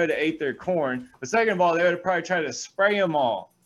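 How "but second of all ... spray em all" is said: ordinary talk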